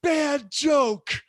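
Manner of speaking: high, loud mock sobbing wail. Kid protests his brother's prank, high loud mock crying. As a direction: wail it like a betrayed ten-year-old. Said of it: "he cries even louder".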